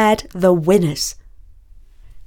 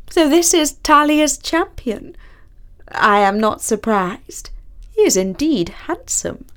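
high regal queen, stately; grand rich voice; 6 semitones higher than usual. A narrator's reading. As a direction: speak as a gracious queen. The Queen speaking.

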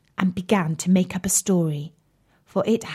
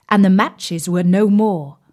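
plain reading voice

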